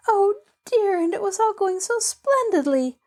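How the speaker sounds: a mild mock sob of disappointment; almost sobbing. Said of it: "almost sobbed Pollyanna".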